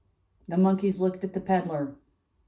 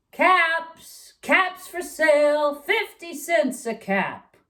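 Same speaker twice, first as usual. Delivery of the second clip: higher, louder street vendor's call; peddler calling out; 9 semitones higher, 4 dB louder, slower than usual. A narrator's reading. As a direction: call out wares to the town. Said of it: "he walked back to town calling".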